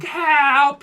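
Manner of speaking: hammed-up panicked shout; imitating a kid yelling for help; mock cry for help. Friend yelling to rich houses.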